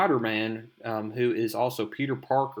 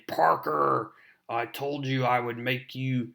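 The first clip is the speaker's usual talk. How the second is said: Green Goblin. Acted. Villain threatening Spider-Man in a comic recap, menacing put-on voice. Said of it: "The Green Goblin is saying".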